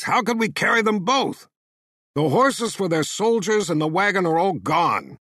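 a hint of an angry captor; heated. A narrator's reading. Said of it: "the first asked in a heated voice".

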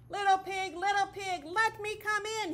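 high, loud wolf call at the door; wolf calling to the little pig; big bad wolf calling. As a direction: call in sweetly menacing as the wolf. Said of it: "he cried".

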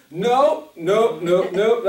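loud, high no no no; inner loud no. Retold or imitated. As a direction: slam the door in your mind. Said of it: "in my spirit".

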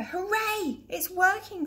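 high, thrilled cheer; excited cheer. Acted. Rainbow machine finally works.

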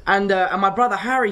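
ordinary talk

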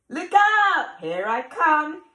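high, loud chase call; loud playful monster. Acted. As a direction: boom it out like a monster chasing. Monster chasing in story.

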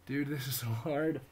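loud, high strained groaning; biting down on pain. Real, not acted. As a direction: push through the burning plank. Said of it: "I'm in a lot of pain".

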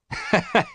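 short high laugh of disbelief; amused laugh. A narrator's reading. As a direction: laugh the idea off lightly. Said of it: "but Harry laughed".

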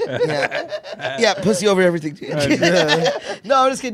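giggling, then taking it back; laughing. Real, not acted. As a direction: laugh through the words. Cracking up at own joke.

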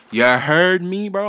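drawn-out New York You heard me; New York accent. Acted. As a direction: stretch it out, New York style. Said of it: "With that New York accent".

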